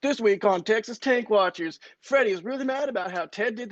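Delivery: emphatic reality show voiceover; mock TV announcer. Pitching an imaginary TV show, mock announcer voiceover. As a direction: tease this week's episode like a promo.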